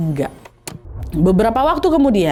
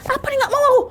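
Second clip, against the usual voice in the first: angry; much faster than usual. Acted. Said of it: "ya marah lah dia".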